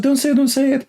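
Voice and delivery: high, urgent don't say it; alarmed hush. Retold or imitated. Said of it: "they said".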